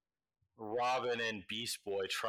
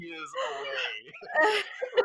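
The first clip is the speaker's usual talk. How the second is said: cracking up mid sentence; high, loud laughing delivery; 10 dB louder than usual. Real, not acted. GM laughing at the answer.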